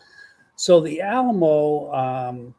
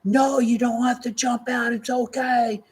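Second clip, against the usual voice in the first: high, mock-urgent call to stop; pilot's shout; 8 semitones higher than usual. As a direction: shout after her not to jump. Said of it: "the pilot was going".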